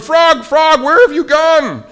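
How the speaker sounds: high, loud frantic calling; desperate scream. A narrator's reading. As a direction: scream after a vanished friend. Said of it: "screamed Toad".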